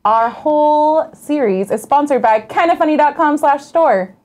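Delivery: muffled by a hand on the mic; covered mic, muffled sound; finger over the mic. Real, not acted. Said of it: "You're covering your mic".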